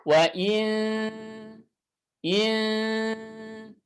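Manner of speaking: thin nasalized ikhfa. Shown as a demonstration. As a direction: hum "in" light and thin. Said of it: "itu dibaca tipis".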